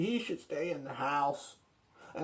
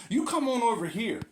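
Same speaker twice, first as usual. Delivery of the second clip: rising threats, ending in a shout; threaten, then yell the last line; arguing with myself; 5 semitones higher than usual. Acted. Argument escalates to threats.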